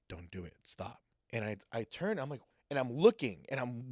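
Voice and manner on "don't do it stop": very soft, restrained retold warning; hushed warning. Mysterious voice stops a man about to swing, hushed warning. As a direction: say it softly, right into the ear.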